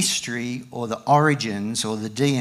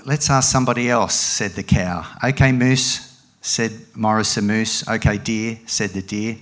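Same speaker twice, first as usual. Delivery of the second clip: animated picture-book reading with raised, loud dialogue; story reading to a child; 5 dB louder than usual. Acted. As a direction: perform the animals' argument, drop for the tags. Reads picture book aloud.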